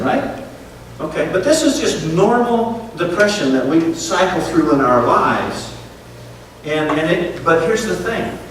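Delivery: normal talking voice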